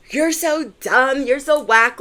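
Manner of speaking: mild mock yell at exes. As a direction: tell off an ex. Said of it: "yelling at my exes".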